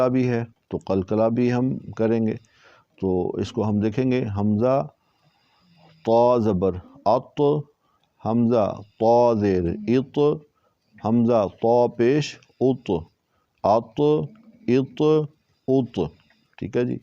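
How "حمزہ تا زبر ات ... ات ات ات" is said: slow "ات" with a full, heavy ط and an echoing bounce; "ات" thick, with qalqala. Shown as a demonstration. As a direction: drill "ات" heavy, bouncing the final ط. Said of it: "اس کو موٹا کر کے پڑھیں گے... قلقلہ بھی".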